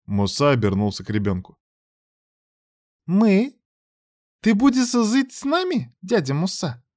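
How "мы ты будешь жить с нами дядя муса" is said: put-on high, careful child's question; little girl asking warily; cautious little girl. A narrator's reading. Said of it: "осторожно спросила малышка".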